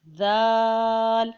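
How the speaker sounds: ذال drawn long with a soft "th" as in "this"; tongue lightly on teeth, stretch ذال gently; soft zaal. Shown as a demonstration. Teacher demonstrating the letter, long soft ذال. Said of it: "ذال کو نرمی سے ادا کیا جائے گا".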